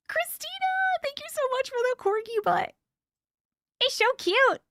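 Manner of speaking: high, gasping squeal of delight; excited thanks. Real, not acted. Streamer gushing thanks to Christina for a cute gift, high and thrilled. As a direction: gush over the gift, bubbling over.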